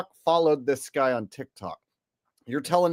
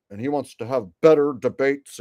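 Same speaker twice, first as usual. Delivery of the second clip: usual speaking voice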